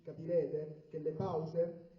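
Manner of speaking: ordinary talk, nothing marked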